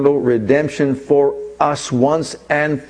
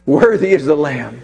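full-force preaching; 7 semitones higher than usual. Acted. Preacher reciting Revelation to his congregation, fervent preaching. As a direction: proclaim it like a revival preacher.

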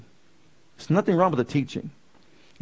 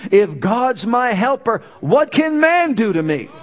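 loud, much higher bold declaration; full-force preaching; 7 semitones higher, 4 dB louder than usual. Acted. Preacher proclaiming the Lord is my helper. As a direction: proclaim it like a shout of faith.

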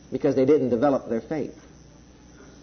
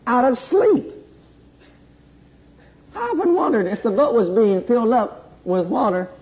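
raised, fervent preacher voice; preaching at full force; 7 semitones higher, 4 dB louder than usual. Acted. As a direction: drive the point home to the church.

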